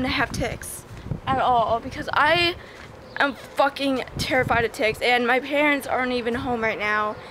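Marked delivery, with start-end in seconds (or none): none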